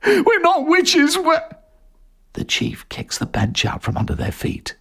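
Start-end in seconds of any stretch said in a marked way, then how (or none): 0.0-1.4 high, slow desperate plea; begging. Acted. Jerry begging the chief with the noose on, high and desperate. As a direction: beg for your life. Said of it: "begs for their lives".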